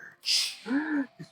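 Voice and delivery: sharp high gasp of surprise; startled gasp. Acted. Shopkeeper gasps at the stranger. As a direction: gasp as if caught off guard.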